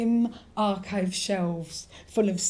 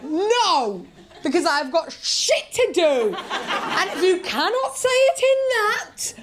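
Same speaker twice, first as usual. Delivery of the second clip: high, loud indignant refusal, drawn out; exasperated outburst; 8 semitones higher, 5 dB louder, slower than usual. Acted. Comic answering pushy book recommenders, exasperated shout. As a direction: bellow it with slow, exaggerated outrage.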